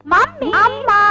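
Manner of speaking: high, drawn-out call to mum; child's pleading call; calling for mother. Acted. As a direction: sing out for your mother, high-pitched.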